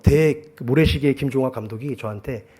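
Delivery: ordinary talk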